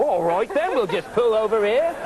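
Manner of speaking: exaggerated British cabbie voice; British cabbie accent. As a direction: answer as a hammed-up Cockney cabbie. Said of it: "how did the cabbie get the accent".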